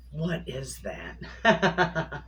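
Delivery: slow, laughing question. Real, not acted. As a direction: laugh through the words. Amused at her own whistling.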